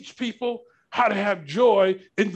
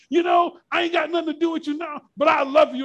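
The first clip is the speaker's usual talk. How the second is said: mock declaration; 8 semitones higher than usual. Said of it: "I can say to that person".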